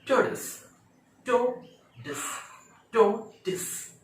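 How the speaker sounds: टॉर्टोइस high and punched as CHAW-dis; tortoise pronunciation drill. Shown as a demonstration. Teacher drills the word for learners, careful pronunciation. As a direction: break टॉर्टोइस into "chaw... tis", short final syllable. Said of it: "ऐसे भी बोल सकते हैं".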